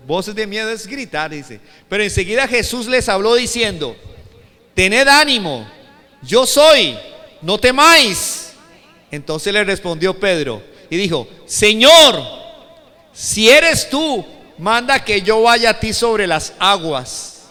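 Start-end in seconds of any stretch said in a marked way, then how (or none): 1.9-11.3 loud, emphatic preacher reading scripture; lectura bíblica declamada. Acted. Lee el pasaje, con palabras de Jesús. 11.5-17.4 very loud, slow, raised-pitch appeal; súplica declamada. Acted. Primera lectura declamada de Pedro.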